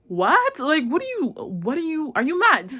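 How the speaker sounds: high-pitched, stammering mock disbelief; retold baffled disbelief. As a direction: act out being totally thrown. Acting out her baffled reaction.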